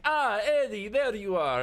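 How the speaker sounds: high, loud exclamation; loud mock-pleased greeting; triumphant discovery. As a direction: crow loudly at finding someone hiding. Gangster finds the hiding man.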